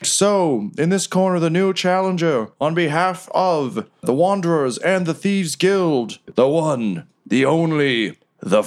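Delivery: high, drawn-out ring announcer build-up; ring announcer intro. Acted. GM as Riker introducing the challenger, slow announcer build-up. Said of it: "And he's like".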